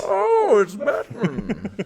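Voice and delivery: very high, slow gasp of delight; hammed-up swooning fan; swooning damsel. Gushing at Batman.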